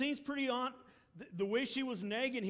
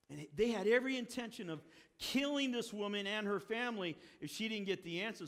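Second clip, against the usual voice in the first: normal talking voice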